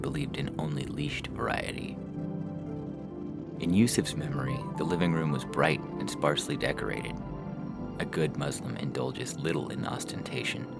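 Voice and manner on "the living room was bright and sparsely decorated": ordinary delivery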